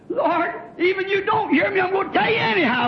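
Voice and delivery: high, urgent plea to God; crying out in prayer. Acted. Young sinner praying though he fears he waited too long, crying out. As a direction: pour it out high and fast. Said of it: "Cry out".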